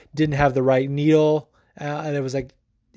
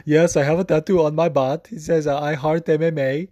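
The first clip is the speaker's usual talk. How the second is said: put-on Swedish accent. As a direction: do Jack's thick Swedish accent. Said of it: "in that thick Swedish, um, accent".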